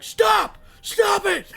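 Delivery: imitating an exasperated wife's shriek; high-pitched mock outburst; angry shouting. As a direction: yell it very high and loud, mock fury. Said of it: "now she fucking gets angry".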